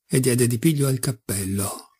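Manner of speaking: usual speaking voice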